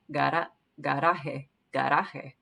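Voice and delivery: "garaje" with the R tapped almost like a D; careful repeated "garaje" with a light D-like R; Spanish tapped R. Shown as a demonstration. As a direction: tap the R in "garaje", don't roll it. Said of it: "It almost is like a D".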